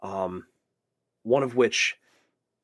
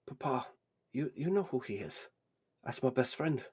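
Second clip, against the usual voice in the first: lightly voiced grandson, softer than usual; 8 dB quieter than usual. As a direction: quietly prompt your grandfather. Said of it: "His grandson's".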